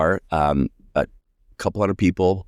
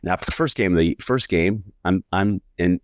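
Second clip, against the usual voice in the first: ordinary talk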